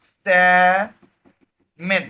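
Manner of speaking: very slow, first syllable held long; drawn-out term. Shown as a demonstration. Teacher giving the class the name of the part, drawn out. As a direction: stretch stamen into two long syllables. Teacher dictates the answer, stretched.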